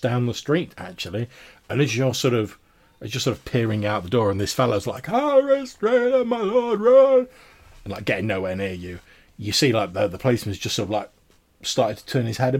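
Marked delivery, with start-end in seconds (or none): 5.1-7.3 high, slow urgent cries; frantic old man shouting. Acted. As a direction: call out to his lord to run. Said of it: "carries on shouting at the top of his voice".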